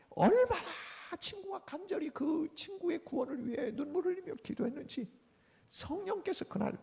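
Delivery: high, fervent rise of emotion; fervent preaching. A narrator's reading. Preacher marveling at the friend's tearful prayer, fervent. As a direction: lift it with passion.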